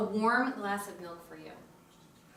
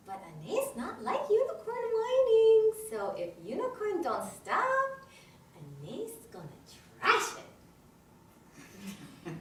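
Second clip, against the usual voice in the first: put-on high baby-talk warning to a toy; swooping, sing-song pretend scolding; mock threat; 10 semitones higher than usual.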